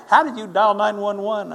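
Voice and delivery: loud, high-pitched disbelieving question; incredulous outburst. Retold or imitated. Retells doctor's incredulous question.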